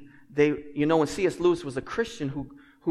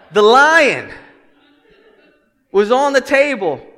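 much louder and higher; emphatic proclamation; 9 semitones higher, 15 dB louder, slower than usual. Real, not acted. Preacher restarting the story after a stumble, emphatic proclamation.

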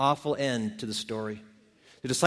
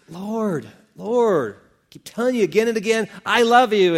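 rising, insistent protest; acted frustration at being asked repeatedly; exasperated pleading; 6 semitones higher, 5 dB louder than usual. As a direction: plead harder, building to a loud finish. Peter answers the third repeated question.

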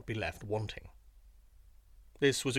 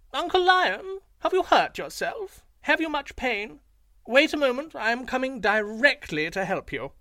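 very high, loud jackal with false sympathy; mock concern; 11 semitones higher, 6 dB louder than usual. A narrator's reading. Jackal pretends concern for the fallen Lion, very high and loud. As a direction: pretend to care while mocking.

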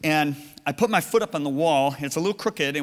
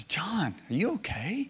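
soft, high, rising question; concerned wife; 5 semitones higher, 5 dB quieter, slower than usual. Retold or imitated. Wife asking her dry-eyed husband in the car, concerned. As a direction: gently check on him in a lighter, higher voice. Acting out worried wife checking on him.

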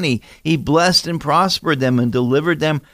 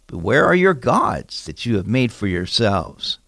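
ordinary delivery

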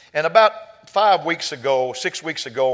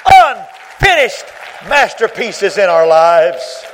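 loud, drawn-out pulpit shout; belted sermon climax; full-force preaching; 5 semitones higher, 10 dB louder, much slower than usual. Real, not acted. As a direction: thunder each word out slowly to the congregation.

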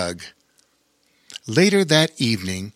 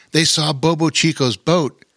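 ordinary delivery

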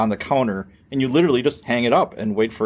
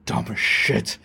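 under his breath; slower than usual. Retold or imitated. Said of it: "and he's like".

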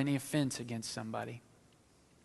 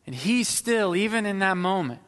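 raised, higher and louder; preaching emphasis; 6 semitones higher, 6 dB louder than usual. Real, not acted. Pastor stressing Jesus' mercy, raised preaching voice. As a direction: build it up with force. Building to the climax.

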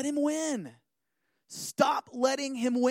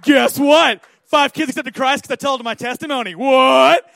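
taunting Satan; 3 semitones higher, 16 dB louder than usual. Retold or imitated. Pastor in his room trash-talking Satan, loud taunting. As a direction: shout it like a gloating rival. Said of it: "I'm like".

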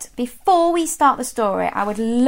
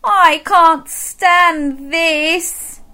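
fed-up bear; 7 semitones higher, much slower than usual. Acted. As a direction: stretch every word like a worn-out bear. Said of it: "said Mister Bear".

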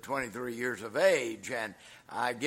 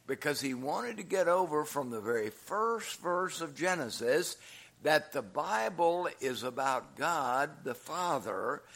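usual speaking voice

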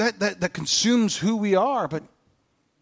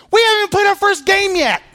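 loud, much higher exasperated outburst; 13 semitones higher, 6 dB louder than usual. Real, not acted. Preacher venting to congregation, high exasperated outburst. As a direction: blurt it out in disbelief.

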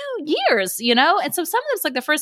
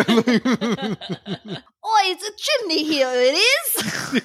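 mock British accent. Joking back at the cohost, mock British accent. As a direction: play a silly British chimney sweep. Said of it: "We can do it, you guys".

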